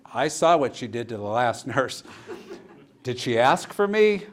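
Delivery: half-laughing, loudly balking at the job; mock alarm. To the nurses, refusing to face the violent patient, loud laughing alarm.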